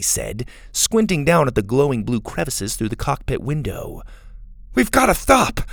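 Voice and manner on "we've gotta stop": high, loud, urgent begging; desperate plea. A narrator's reading. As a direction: plead urgently, pitched way up. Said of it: "Max said desperately".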